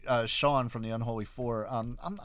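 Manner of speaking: ordinary talk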